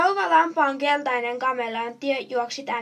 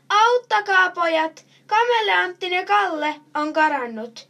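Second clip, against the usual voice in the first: loud, higher-pitched cry for help; shouting for help; 6 semitones higher, 6 dB louder than usual. A narrator's reading. Sheep lady calls boys to help find runaway chameleon, shouting. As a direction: call out loudly to the boys. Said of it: "ja huutaa".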